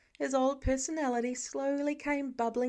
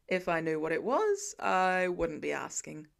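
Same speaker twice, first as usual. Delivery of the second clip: deadpan; 5 semitones lower than usual. A narrator's reading. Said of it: "Tony deadpans".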